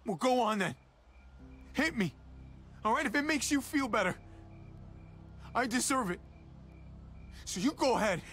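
high-pitched, worked-up dare; agitated, self-blaming challenge; goading. Acted. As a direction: dare him to hit you. Dares brother to hit him.

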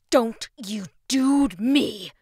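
slow loud scolding mother; angry snap. A narrator's reading. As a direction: bite off each word. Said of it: "his mother snapped back".

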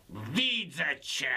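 slow, drawn-out demonic voice; devil from hell voice. Acted. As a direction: speak like a devil rising from hell. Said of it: "jakby głos diabła z piekła". (demoniczny głos diabła z piekła)

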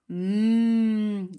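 long, loud hungry hum; hungry mmm. Acted. Teacher voicing hungry cat for children, hungry mmm. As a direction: hum as if craving food.

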